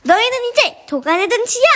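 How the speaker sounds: high, heated villain taunt; gloating threat. Acted. Villain threatens cornered heroes.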